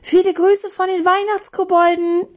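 high, triumphant taunting call; sustained high-pitched shout. Acted. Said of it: "rief Julebuck".

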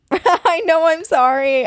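high-pitched apology through laughter; laughing apology. Real, not acted. Host apologizing to scared co-host, laughing. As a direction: laugh while saying sorry.

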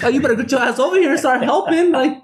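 high, incredulous retold snap; mock outrage at the long line; mock exasperation. S1 retells his outburst at the cashier, high and incredulous. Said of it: "I'm like".

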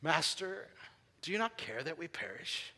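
lightly voiced King James disciples, hushed; mock formal disciples. Preacher mocking the stiff Bible wording, hushed and formal. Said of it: "'Cause we don't talk like that".

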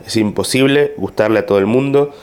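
ordinary talk

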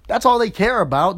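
ordinary talk, nothing marked

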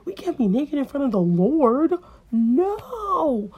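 rising loud panic to a high no; high frantic cry; panicked scramble. Acted. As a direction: scramble in fright. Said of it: "they scramble, like".

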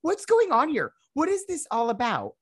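very high confused squeal; very high confused outcry; high bewildered outcry. Retold or imitated. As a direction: pitch it way up, baffled. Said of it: "I'm like".

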